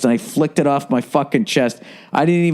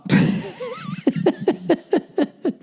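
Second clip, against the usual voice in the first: high wheezing laugh; real laugh; 4 dB quieter than usual. Real, not acted. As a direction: break into laughter. Laughing at story.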